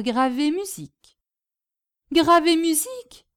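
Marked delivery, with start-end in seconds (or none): 2.1-3.3 high questioning repeat, rising at the end; amazed echo. Acted. Hostess hearing that her guest engraves music, amazed. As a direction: echo it in happy disbelief.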